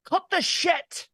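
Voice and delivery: high, slow Scottish bark; Scottish accent. Acted. Co-host answering a request, high Scottish accent. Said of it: "in Scottish, like Doc says it".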